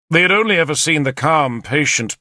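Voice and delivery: plain reading voice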